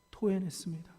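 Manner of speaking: a hushed echo of the word; quiet echo. Acted.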